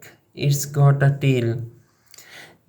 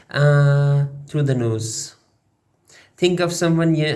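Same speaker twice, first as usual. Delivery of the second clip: usual speaking voice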